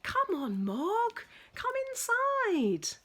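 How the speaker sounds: high, loud, slow coaxing call; calling the cat. Acted. Nicky calling Mog inside, high and coaxing. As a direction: coax the cat in from the rain.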